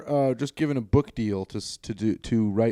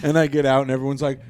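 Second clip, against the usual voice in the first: normal talking voice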